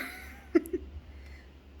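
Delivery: brief self-amused laugh. Real, not acted. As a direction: let out a short amused laugh. Laughing at own joke.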